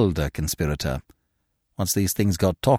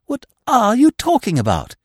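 high, impatient question; exasperated bafflement; 11 semitones higher, 4 dB louder than usual. A narrator's reading. Eve questions Freddy's odd outburst, exasperated bafflement. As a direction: demand it in baffled irritation.

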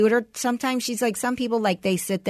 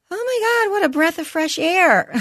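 high, delighted, slightly louder gush; 11 semitones higher, 4 dB louder than usual. Retold or imitated. Therapist gushing to mom about her son, high and delighted. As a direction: gush like you can't believe your luck.

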